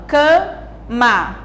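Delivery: CAMA split as ca, ma with a gap; syllable by syllable. Shown as a demonstration. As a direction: say CAMA in two separate, drawn-out beats. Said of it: "palavras com duas sílabas".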